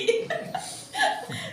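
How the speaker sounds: very high peal of laughter; delighted laugh. Real, not acted. Teacher delighted about meeting her mentor, high laugh.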